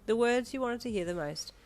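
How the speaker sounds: ordinary delivery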